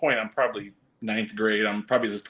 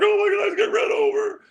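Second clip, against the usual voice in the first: high, loud frantic warning; alarmed ladies shouting at a kid in the road; panicked shriek; 5 dB louder than usual. Retold or imitated. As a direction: scream it as if a car is about to hit him. Ladies fear car hits him.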